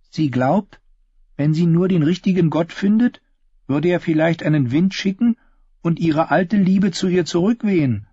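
high-pitched storytelling voice of Yna; einäugige Yna. Acted. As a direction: voice Yna's gossip, pitched well up. Voices one-eyed Yna in the story. (hohe Figurenstimme der einäugigen Yna)

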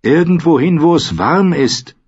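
put-on high, loud old woman; old woman's high, emphatic reply; two old women answering as one. A narrator's reading. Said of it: "wie aus einem Mund".

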